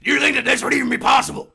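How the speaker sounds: fast, loud, high broadcaster shout; Alex Jones voice. Shown as a demonstration. Host proving the voice needs smoking, shouting. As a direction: blast it out in the rant voice. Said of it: "such a good Alec Jones".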